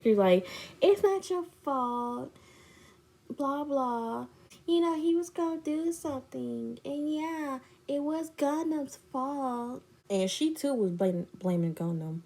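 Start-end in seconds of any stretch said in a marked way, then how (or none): 0.8-9.8 high, softly imitated consoling; fake comforting. Friend blames someone else for the breakup, fake comforting. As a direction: mimic a friend's put-on sympathy. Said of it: "fake comforting me".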